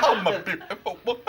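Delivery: mock overwrought soap voice; hammed-up impression of an upset actor; Norman Vaughan. Presenter mocking EastEnders acting, comic impression. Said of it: "they talk like that bloke, Norman Vaughan".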